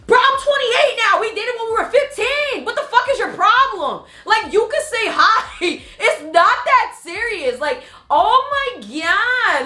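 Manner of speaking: high, loud, incredulous outburst; exasperated rant. Acted. Ranting at jealous partners over a simple hello, exasperated. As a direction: pitch it way up and stretch the key words.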